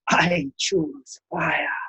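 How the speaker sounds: slow, soft, deliberate; quiet resolve. Acted. Master of Fire calmly accepts the giant's challenge, slow and soft.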